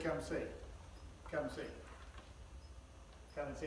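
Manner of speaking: soft, easy come see; gentle invitation. Acted. Philip calmly inviting doubting Nathanael, softly. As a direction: coax him softly, no argument. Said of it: "he didn't argue with him. He just said".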